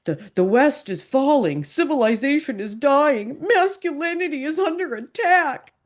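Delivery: high-pitched mock doom-saying, climbing with each claim; melodramatic, mock-serious cry of alarm; theatrical panic. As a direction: imitate alarmists proclaiming collapse, hammed up. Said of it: "Like, dude, this is so theatrical".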